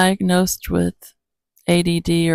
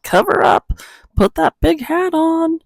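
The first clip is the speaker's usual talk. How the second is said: much higher, louder bossy warning; nagging voice; 12 semitones higher, 4 dB louder than usual. Retold or imitated. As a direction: mimic a fussy scold, pitched way up. Voicing anti-aging nagging.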